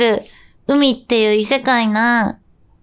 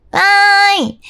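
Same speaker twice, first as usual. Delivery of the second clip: cheer; 8 semitones higher, 4 dB louder, much slower than usual. Acted. Cheering the correct answer.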